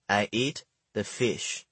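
put-on slow, soft Chinese-accented English; thick Chinese accent. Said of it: "in my thick Chinese accent".

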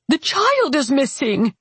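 high, alarmed roar from the aunt. A narrator's reading. Aunt bursting into the bedroom over the missing child, roaring. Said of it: "exploded into our bedroom and roared".

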